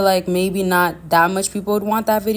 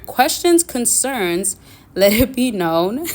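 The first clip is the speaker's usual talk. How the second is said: deliberately slowed down; 3 semitones higher, 3 dB louder, slower than usual. Real, not acted. Vlogger redoing her fumbled sign-off, deliberately slowed down. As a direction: take your time over each word. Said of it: "let me slow down".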